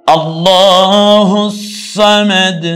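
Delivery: আল্লাহু held long, loud and high, then a short সামাদ; deep-voiced recitation. Shown as a demonstration. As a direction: sing out আল্লাহু slowly from the throat. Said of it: "গম্ভীর আওয়াজে". (গম্ভীর আওয়াজে টেনে পড়া)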